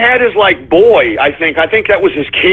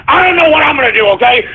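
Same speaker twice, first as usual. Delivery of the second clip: ordinary talk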